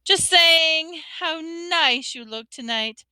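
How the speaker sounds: high, slow forced compliment; falsely bright. A narrator's reading. Hermione flattering the ghost to cover up, falsely bright. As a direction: cover up with too-sweet flattery.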